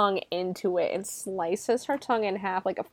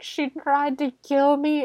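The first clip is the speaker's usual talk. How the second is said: higher, slower, shaken; shaken claim; 6 semitones higher than usual. Retold or imitated. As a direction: blurt it out still in shock. Eric shaken after the attack.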